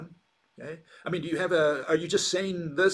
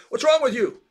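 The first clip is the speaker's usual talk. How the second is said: calling out; 8 semitones higher, 7 dB louder than usual. Retold or imitated. Other Cyclops call into cave.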